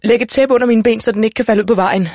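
high, loud urgent orders; mother in labour calling out instructions; shouting. A narrator's reading. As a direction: shout for help in a panic. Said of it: "råbte mor".

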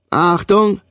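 sharp high call to attention; shouted command. A narrator's reading. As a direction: bark it out like a sergeant. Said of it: "rief Löhr".

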